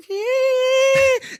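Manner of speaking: long, loud, very high squeal; high-pitched squeal. Retold or imitated. Showing co-host how the man froze as the dog approached, high squeal. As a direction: let out one sustained piercing squeal. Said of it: "He's going".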